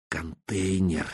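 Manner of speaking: Контейнер said softly and low, falling; hushed echo. A narrator's reading. Narrator repeats Контейнер to herself, hushed. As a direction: linger on Контейнер quietly.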